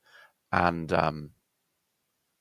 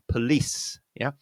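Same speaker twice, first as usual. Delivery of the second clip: ordinary talk, nothing marked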